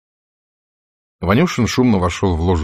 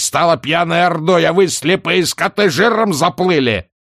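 higher, louder raging tirade; furious shout; 7 semitones higher, 4 dB louder than usual. Acted. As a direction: rage at the delegates. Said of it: "и крикнул".

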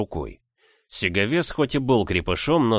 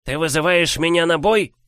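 squeaky mocking giant; 8 semitones higher, 3 dB louder than usual. Giant mocks hero.

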